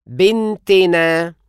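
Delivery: بنتنا said slowly as bin-te-na, helping vowel before na; slow pronunciation demo. Shown as a demonstration. Teacher slowly models the na ending for learners. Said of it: "كسرة زائد نا".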